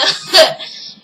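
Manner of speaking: short breathy laugh. Real, not acted. Laughing at her own slip.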